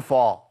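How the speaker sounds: فا said thick and heavy, like an emphatic. Retold or imitated. Teacher mocks people who thicken the f. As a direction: darken the فا into a thick vowel. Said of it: "فا دي مش موجودة".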